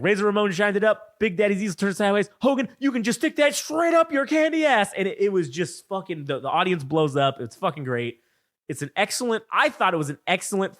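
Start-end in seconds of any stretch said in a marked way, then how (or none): 0.0-4.9 higher and louder, building to a cocky punchline; brash trash talk building to the insult; Rock promo voice. Retold or imitated. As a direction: cut a swaggering wrestling promo. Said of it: "he's like, he's like".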